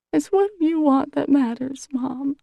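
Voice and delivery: high, tearful blubber through the words; pushing brave words out while crying. A narrator's reading. Daughter crying to her mother about the separation, high and blubbering. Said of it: "she blubbers".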